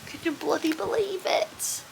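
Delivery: ordinary talk, nothing marked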